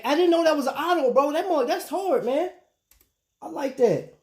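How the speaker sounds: high, fast excited reaction; real delighted amazement; excited surprise. Real, not acted. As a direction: gush about a viewer's watch. Reacting to viewer's watch in chat.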